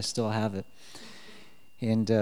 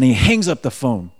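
ordinary talk

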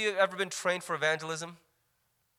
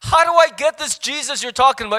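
eager seeker; 4 semitones higher, 9 dB louder than usual. Retold or imitated. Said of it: "this person goes".

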